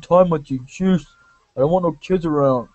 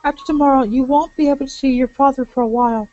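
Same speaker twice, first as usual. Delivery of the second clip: higher, gentle pleading woman; 9 semitones higher than usual. Acted. Voices Sonya.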